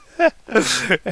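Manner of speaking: loud lustful moan; heavy moan. Acted. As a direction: moan heavily. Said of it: "He heavily moaned".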